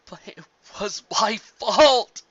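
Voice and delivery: high, loud and slow, breaking into a cry; sobbing yell. Acted. Thade insisting to the mare it was his fault, sobbing yell. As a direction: yell it through tears, voice cracking. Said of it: "yelled through his sobs".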